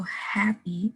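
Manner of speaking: "happy" slowed, with breath out on the H; breathed H shown. Shown as a demonstration. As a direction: draw out "happy" from a breathed "huh". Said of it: "breathing out for that huh sound".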